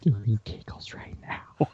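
slow, hushed whisper. Shown as a demonstration. Card reader redoing the answer on request, slow whisper. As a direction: whisper it slowly, with no voice at all. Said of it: "You gotta say that in a whisper voice".

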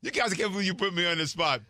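ordinary delivery

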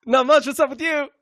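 high, loud comic comeback; playing along loudly with a gag; mock-eager reply. Acting out the up-dog gag reply.

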